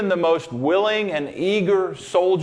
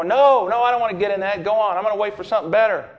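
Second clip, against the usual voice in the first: higher, fast, stubborn refusal; stubborn drowning man; 6 semitones higher, 3 dB louder, faster than usual. Retold or imitated. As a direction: wave them off, sure something better is coming.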